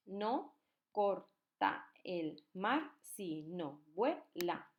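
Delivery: very slow, every syllable drawn apart; slow syllable reading. Shown as a demonstration. Teacher reading the next verse slowly for counting. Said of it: "Seguimos con el siguiente verso".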